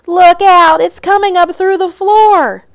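high, loud panicked warning; loud storybook alarm, pitched high; alarmed shout. A narrator's reading. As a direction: cry out to warn everyone. Animals shout warning as floorboards move.

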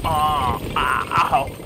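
loud, high mock yelp; hammed-up ouch after a hit; pained yelp. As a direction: yelp as if struck. Hit by building.

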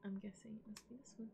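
whispered single word; hushed, sneaking around; soft whispered command to self. Real, not acted.

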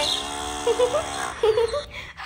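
high-pitched giggling from being tickled; ticklish giggle. Acted. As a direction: giggle helplessly in your sleep. Sleeper's foot is being tickled. (गुदगुदी वाली खिलखिलाहट)

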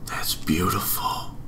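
hushed whisper, mom asleep nearby. Real, not acted. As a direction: whisper so nobody wakes. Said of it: "I'd probably yell about it right now, but I don't wanna wake my mom up".

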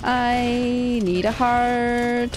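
very slow, stretched-out wail; desperate plea. Real, not acted. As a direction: drag out every word in despair. Desperate for health in game.

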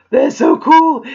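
high, loud gasp then gushing; gasp, then gush over it; excited gasp and gush. Real, not acted. Unboxing a gift, delighted.

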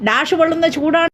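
usual speaking voice

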